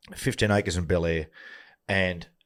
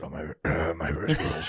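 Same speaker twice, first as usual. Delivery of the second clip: lower, softer croak of a worn-out voice; Darren Lockyer; 5 semitones lower, 5 dB quieter than usual. Retold or imitated. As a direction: croak it out, clearing the throat mid-line. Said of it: "You sound like Darren Lockyer".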